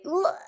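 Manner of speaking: a sharp high "ugh" of disgust; disgusted groan. Pretend. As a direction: groan like it turns your stomach. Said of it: "we'd just be like".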